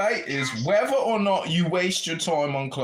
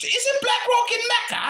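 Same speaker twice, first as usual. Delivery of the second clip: pitched much higher, ranting put-on character voice; mock-outraged put-on character yelling at the room; put-on comic voice; 3 dB louder than usual. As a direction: rant in a loud, high, put-on voice. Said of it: "I guess I can stop talking like this now".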